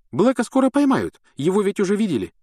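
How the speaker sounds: put-on girlish Hermione voice. A narrator's reading.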